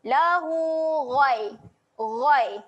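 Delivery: a full, thick "Ghai"; heavy ghain. Shown as a demonstration. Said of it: "tebalkan bunyi huruf ghain". ("Ghai" dengan bunyi tebal)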